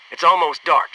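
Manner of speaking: ordinary delivery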